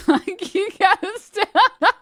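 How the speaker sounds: high, loud, laughing "you gotta stop"; laughing plea. Real, not acted. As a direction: crack up while begging him to stop. Repeat, much higher and louder laughing.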